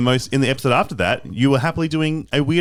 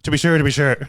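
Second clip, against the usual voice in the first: higher, hammed-up Irish brogue; mock Irish accent; 4 semitones higher, 3 dB louder, faster than usual. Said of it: "It's not that good".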